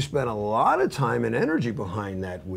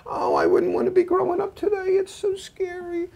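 very high, near tears, mother's voice; mother dreads growing up today, high and weepy; fearful mother. Retold or imitated. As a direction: let the worried mom crack with fear. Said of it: "And she goes".

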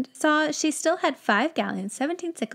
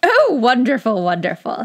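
louder delighted exclamation; excited wizard; 2 semitones higher, 8 dB louder than usual. Acted. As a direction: burst with delight. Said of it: "got very excited".

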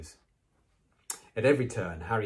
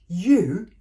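loud, high outraged exclamation; angry high-pitched outburst; furious; 11 semitones higher, 12 dB louder than usual. A narrator's reading. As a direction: burst out in furious disbelief. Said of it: "said Ron furiously".

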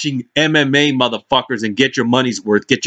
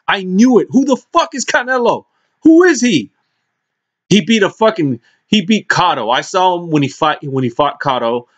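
ordinary talk

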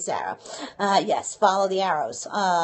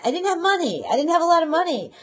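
animated confession; 8 semitones higher, 6 dB louder, faster than usual. Real, not acted. Laughing admission of no money.

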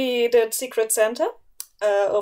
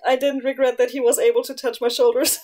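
normal talking voice

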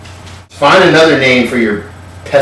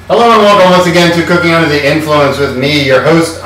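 upbeat TV-host welcome, higher in pitch; host intro; 5 semitones higher, 4 dB louder than usual. Acted. Host welcomes viewers to the show in an upbeat TV-host voice. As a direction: open the show like a cheesy TV chef.

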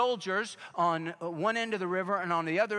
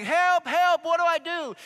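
high, loud desperate shouts; panicked yelling; 12 semitones higher, 8 dB louder than usual. Retold or imitated. Agent chased by the bull, screaming high for help. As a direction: yell in terror as he runs. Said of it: "the guy's like".

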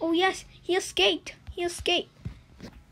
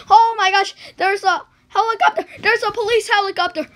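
loud, higher, fast cry about the helicopter; alarmed shout; 6 semitones higher, 11 dB louder, faster than usual. Real, not acted. Gamer spotting the police helicopter, loud alarmed cry.

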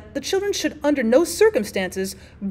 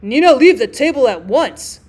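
loud, angry father's command; angry cry; 9 dB louder than usual. A narrator's reading. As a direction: order a child away in anger. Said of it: "cried her father angrily".

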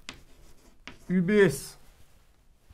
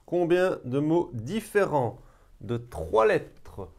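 slow emphatic repeat; 4 semitones higher, 3 dB louder, slower than usual. Shown as a demonstration. Re-asking the question to the class.

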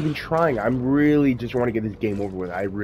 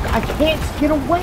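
panicked yell; 6 dB louder than usual. Real, not acted. Panicked gaming outburst.

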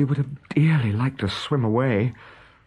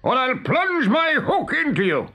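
high threatening captain's cry; Hook imitation. A narrator's reading. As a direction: threaten the crew as their captain. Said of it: "he now imitated the voice of Hook".

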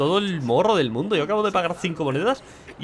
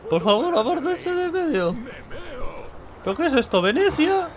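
hammed-up drunk voice, pitched way up; 13 semitones higher than usual. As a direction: play a tipsy drunk asking the bartender. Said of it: "Esta es mi voz de borracho".

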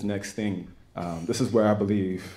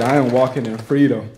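louder, ringing declaration of freedom; loud proclamation; 3 semitones higher, 9 dB louder than usual. Retold or imitated. Telling his wife he is free, loud proclaiming. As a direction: proclaim it to her with full force. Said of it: "I said".

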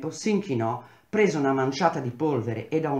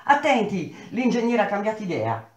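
shouted warning; 7 semitones higher than usual. A narrator's reading. Young worker shouts a warning to his comrades. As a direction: yell the warning across the crowd. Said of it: "gridò".

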